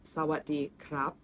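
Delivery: 'Sawatdee krap' slowly, syllable by syllable; slow model pronunciation. Shown as a demonstration. Teaching hello with the polite particle, slow demonstration. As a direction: say Sawatdee krap slowly for learners to copy. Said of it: "you can say".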